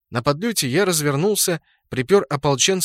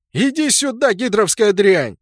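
much higher, louder insult; angry shout; 7 semitones higher, 5 dB louder than usual. A narrator's reading. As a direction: yell from far off. Distant enemy shouting insults.

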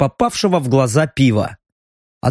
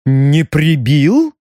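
suspicious cook; 3 dB louder, much slower than usual. A narrator's reading. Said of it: "прищурилась повариха".

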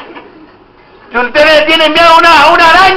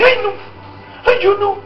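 mock timid whine; 7 semitones higher, 8 dB quieter, faster than usual. Mocks timid believer.

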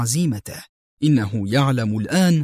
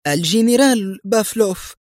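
high, nervous admission; acted strained reply, pitched up; tense answer; 8 semitones higher than usual. A narrator's reading. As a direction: give up the name uneasily. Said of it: "غمغمت الحارسة في توتر".

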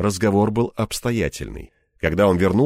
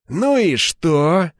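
slow, drawn-out high question; probing challenge; 7 semitones higher, 4 dB louder, much slower than usual. A narrator's reading. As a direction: stretch it out, inviting him to go on. Главарь прищурился, понимая намёк. (протяжно, с прищуром)

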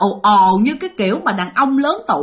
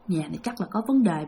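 inner suspicion; 4 semitones lower, 8 dB quieter than usual. Retold or imitated. Suspicion kept to herself.

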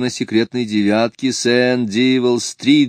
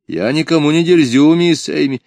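higher, louder denial; indignant protest; 7 semitones higher, 5 dB louder than usual. A narrator's reading. Missouri denying to Miss Amy that she is cheeky, indignant. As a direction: protest your innocence, voice raised.

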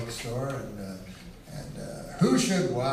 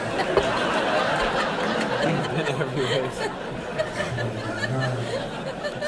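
drawn-out giggle after the punchline; laughing it off; long laugh. Real, not acted. Comic laughing long at his own story.

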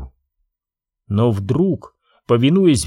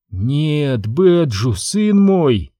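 ordinary delivery

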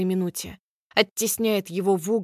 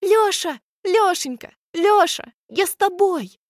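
high shrill cheering shrieks; cheerleader shrieking; 10 semitones higher, 6 dB louder than usual. Acted. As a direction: squeal his name like a cheerleader. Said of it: "верещит".

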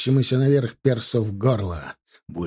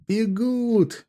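higher, exultant cry that the enemy is running; delighted shout; 10 semitones higher than usual. A narrator's reading. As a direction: call it out in triumph. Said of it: "крикнул в восторге Марков".

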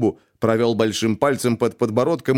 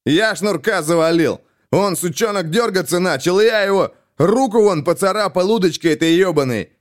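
boastful butting in; 7 semitones higher, 3 dB louder than usual. A narrator's reading. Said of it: "снова вклинился Шейн".

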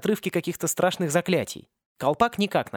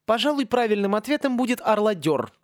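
a touch of grandeur, higher; majestic; 7 semitones higher than usual. A narrator's reading. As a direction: pronounce it like a royal decree. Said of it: "величественно произнёс Сен". (величественно, торжественно)

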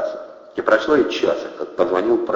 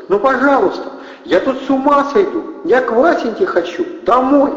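loud, very high begging; pleading; 4 dB louder than usual. A narrator's reading. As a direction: beg hysterically in a high wail. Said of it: "взмолилась Кристина Бонасюк". (истеричная мольба, очень высоко)

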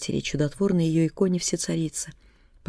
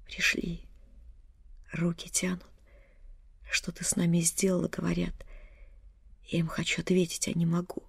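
soft whisper with breaking breath; broken breathing whisper; 7 dB quieter than usual. A narrator's reading. Dying mother whispers her vision to her daughter. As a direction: whisper between failing breaths. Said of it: "Дыхание прерывалось".

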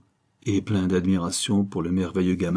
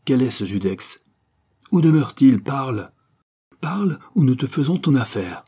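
higher, louder threatening demands; furious interrogation; 4 semitones higher, 5 dB louder than usual. Acted. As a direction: bark the questions in rage. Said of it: "leur fureur ne connut plus de bornes".

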